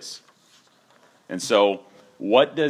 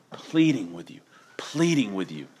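whisper. Real, not acted. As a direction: breathe it as a hushed plea. Repeats softly for effect.

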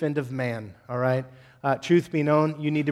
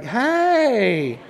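higher, drawn-out hey; mock smooth greeting; 7 semitones higher, 4 dB louder than usual. Guy to girl, greeting her with a higher drawn-out hey. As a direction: greet her like nothing happened. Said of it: "He's like".

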